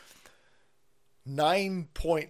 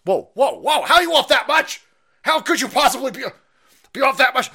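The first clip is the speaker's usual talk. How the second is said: much higher, louder incredulous outburst; disbelief; 12 semitones higher, 10 dB louder than usual. Real, not acted. Host reacting to the job revision, in loud disbelief. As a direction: shout in disbelief. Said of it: "outraged at revised numbers".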